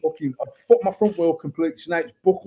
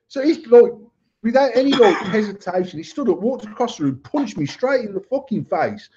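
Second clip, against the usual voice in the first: ordinary talk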